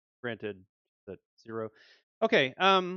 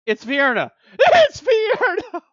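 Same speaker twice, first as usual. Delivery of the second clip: much higher, loud, the second drawn out slowly and laughing; shocked shout; 19 dB louder, slower than usual. Real, not acted. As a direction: yell the name in stunned disbelief. Realizes it is Vierna.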